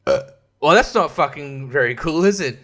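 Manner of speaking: ordinary talk, nothing marked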